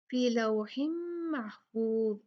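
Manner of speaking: slow फी लौहिम with the m held; iqlab demo. Shown as a demonstration. Teacher showing why meem is here, लौहिम held on a hummed m. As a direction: hum the m of लौहिम into महफूज. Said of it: "यहाँ पर मीम क्यों है".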